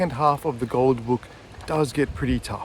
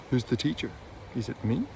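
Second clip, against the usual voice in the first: normal talking voice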